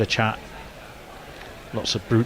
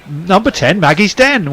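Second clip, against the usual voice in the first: raised, lilting bingo call; bingo caller; 7 semitones higher, 5 dB louder, slower than usual. Pretend. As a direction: audition as a bingo hall caller. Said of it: "Can I audition".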